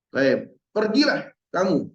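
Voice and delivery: ordinary talk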